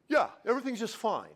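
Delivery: light, airy doctor reassurance, voice changed; a cheery doctor covering up the truth; fake-breezy doctor. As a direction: brush it off like a doctor hiding bad news. Doctor answers patient, acted exchange.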